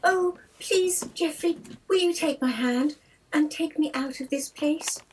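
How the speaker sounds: ordinary talk, nothing marked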